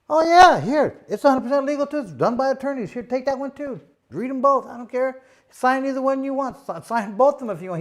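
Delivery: hammed-up breezy salesman, nothing to hide; eager promoter. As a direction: promoter overselling that he doesn't care. Promoter eagerly hands over second contract.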